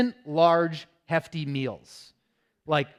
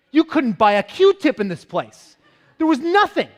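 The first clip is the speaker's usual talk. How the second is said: exasperated; 9 semitones higher, 9 dB louder than usual. Retold or imitated. Exasperated telling of empty store.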